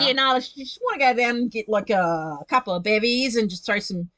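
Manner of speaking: put-on broad Aussie accent. Bloke planning beers and barbecue, broad Aussie accent. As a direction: lay on thick Australian slang and vowels. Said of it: "kind of accent".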